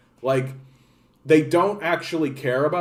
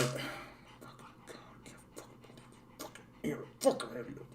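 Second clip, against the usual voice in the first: harsh exasperated whisper; whispered curse; 2 semitones lower, 19 dB quieter than usual. Retold or imitated. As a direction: hiss it under your breath.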